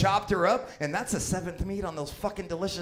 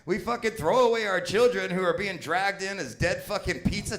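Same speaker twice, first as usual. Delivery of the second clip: ordinary delivery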